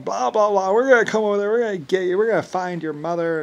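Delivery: hammed-up taunting bully; mocking threat voice. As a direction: sneer the threats back like a cartoon villain. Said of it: "people from Hollywood or whatever going".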